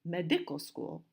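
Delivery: medical school with DI stressed in medical; wrong middle-syllable stress. Shown as a demonstration. Teacher shows what we don't say, medical stressed on DI. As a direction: wrongly punch the second syllable, me-DI-cal school. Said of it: "We don't say".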